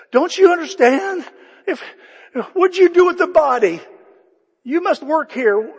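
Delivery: high, loud, desperate pleading. Acted. Mary pleading with the gardener.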